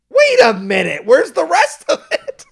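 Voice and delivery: hammed-up disbelief that the film just stopped; mock outrage. A viewer indignant the movie ended, mock outrage turning to laughter.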